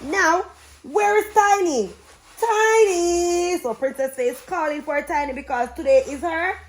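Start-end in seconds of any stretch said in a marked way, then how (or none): 0.0-3.6 high, louder sing-song call of the name. A narrator's reading. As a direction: sing out for the missing cat, high pitched. Princess calling for her cat.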